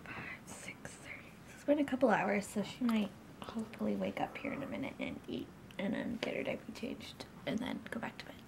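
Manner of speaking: hushed whisper. Real, not acted. Whispering near sleeping baby.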